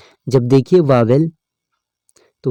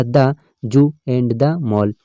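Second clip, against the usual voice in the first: sentence read out slowly, word by word; slow reading aloud; much slower than usual. Shown as a demonstration. Teacher reads the example sentence slowly to students. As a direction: dictate it slowly to the class. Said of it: "teacher reads example sentence".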